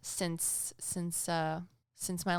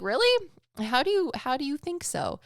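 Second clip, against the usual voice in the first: curious surprise; 7 semitones higher, 4 dB louder than usual. Retold or imitated. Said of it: "I was like".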